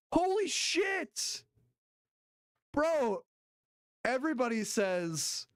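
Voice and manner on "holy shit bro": slow high amazed. Real, not acted. Reacting to face.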